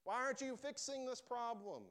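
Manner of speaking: loud, high-pitched frustrated demand; re-enacted exasperated shouting; upset, frustrated. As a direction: cry out at an absent God. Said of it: "I was so upset and frustrated".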